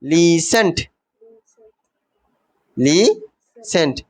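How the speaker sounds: লিসেন্ট said lis-en with a silent t, drawn out slowly; the teacher models লিসেন্ট slowly for pupils, silent t; listen said right. Shown as a demonstration. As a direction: say লিসেন্ট slowly and clearly, skipping the t sound. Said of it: "কিন্তু উচ্চারণটা হবে কি?".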